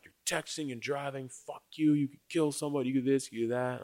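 high, restrained mock yell; retold yelling. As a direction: imitate a driver's angry tirade without the volume. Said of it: "he's still yelling at me".

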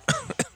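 sharp choking cough; coughing fit. Real, not acted. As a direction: cough as if something went down wrong. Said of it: "me ahogué".

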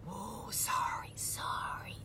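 slow hushed whisper. Retold or imitated. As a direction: back off in a sheepish whisper. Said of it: "they're like".

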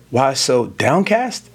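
"downcast?" drawn out slowly with a rising tune; downcast as a question. Shown as a demonstration. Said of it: "I read downcast as a question".

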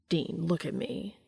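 slow, soft and earnest; tender plea to a partner; gentle insistence. A narrator's reading. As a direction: coax him to look at you.

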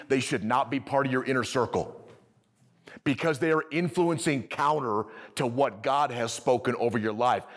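ordinary talk, nothing marked

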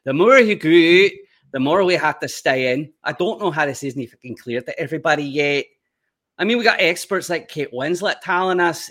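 loud, high-pitched Glaswegian rant; broad Scottish accent at full volume. Acted. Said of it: "the Glaswegian Scottish one".

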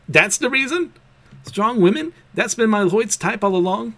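put-on a girl who just cracked the secret, giddy; excited realization. Becky learns Lloyd likes strong women, thrilled.

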